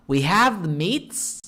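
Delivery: slow, deadpan ad line; deadpan slogan read. Shown as a demonstration. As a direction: read it slowly, no excitement. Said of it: "punctuation really changes the vibe of a sentence".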